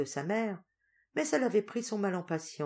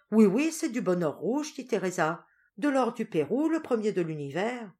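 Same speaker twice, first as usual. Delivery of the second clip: high, louder eager yes; eager reassurance; 5 semitones higher, 5 dB louder than usual. Acted. Teresa vouching for the Peruvian gold, eager. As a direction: reassure her quickly.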